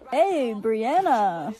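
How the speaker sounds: slow, drawn-out flirty hello; lingering, lovestruck drawl; smitten greeting.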